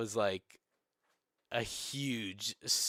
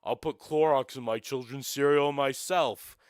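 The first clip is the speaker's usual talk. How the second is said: louder put-on voice of a spiteful partisan; hammed-up mock outrage, loud and silly; mock hater voice; 3 semitones higher, 7 dB louder than usual. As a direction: ham up an absurd, petty straw man. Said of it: "To be like".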